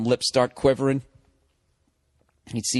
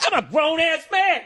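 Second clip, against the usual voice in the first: much higher, puffed-up grown ass man; mock macho boast. Comic mocking the grown-man expression in a higher puffed-up voice. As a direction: puff it up like a proud tough guy, mocking the phrase. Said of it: "but if you're a grown ass".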